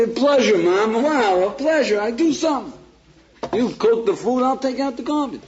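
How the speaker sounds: high, bright-eyed overeager helper, hammed up; mock eager son. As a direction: play the too-willing son offering help.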